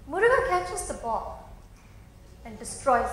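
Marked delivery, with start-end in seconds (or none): none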